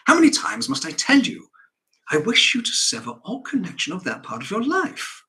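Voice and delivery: loud, high-pitched husband's reprimand; worked-up, commanding rebuke; exasperated scolding. Acted. Father scolds wife.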